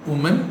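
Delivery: Woman as WU-mun, rising; singular pronunciation. Shown as a demonstration. Teacher contrasting singular with plural, singular pronunciation. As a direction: say Woman with an oo sound. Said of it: "ஊ sound வரும்".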